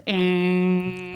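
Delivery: long, monotone horn drone; imitated car horn. Daughter recalling the stuck car horn, imitated drone. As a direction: hold one steady note like a stuck horn. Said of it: "all I heard was".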